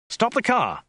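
high, urgent call; sudden shout. A narrator's reading. Passenger spotting a sign calls to driver, sudden shout. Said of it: "shouted Dirk suddenly".

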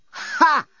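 short high scoff; single dismissive "ha", pitched high; insolent "ha". A narrator's reading. Doorbot answering Dan's upgrade request, insolent scoff. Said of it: "Corporate dumb insolence".

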